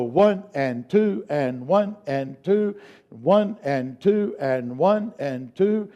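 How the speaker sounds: slow, even count. Shown as a demonstration. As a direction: count it out slowly. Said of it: "Now let's speed it up".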